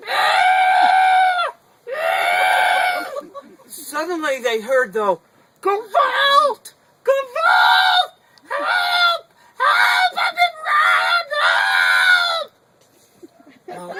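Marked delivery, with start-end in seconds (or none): 0.1-3.0 scream. Acted. Stranger screams again in shul. 5.6-12.5 frantic, very high and loud shouting; robbed stranger shrieking for help in the shul; cry for help. Acted.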